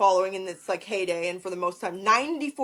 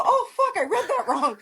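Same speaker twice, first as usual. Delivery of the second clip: mock dismay; 9 semitones higher than usual. Acting out her sudden realisation.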